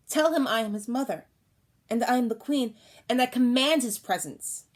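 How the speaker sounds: queen giving a loud order to the doctor; royal command. Acted.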